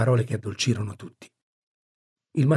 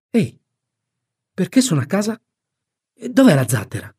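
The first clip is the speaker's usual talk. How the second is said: put-on higher-pitched boy's questions; voiced waking boy; 8 semitones higher, 4 dB louder than usual. Tom wakes asking about the raft, higher boyish voice. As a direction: ask as a puzzled boy just awake.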